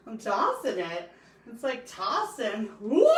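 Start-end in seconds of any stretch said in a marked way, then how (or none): 2.8-3.1 startled yelp. Real, not acted. Dough tossing mishap.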